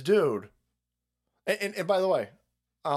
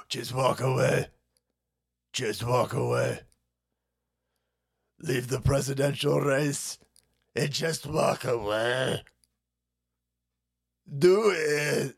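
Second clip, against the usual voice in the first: slow, steady villain-style command; spaced-out orders in a put-on voice, long pauses between; mock ominous ultimatum; slower than usual. Threateners telling candidate to leave the race, mock ominous ultimatum.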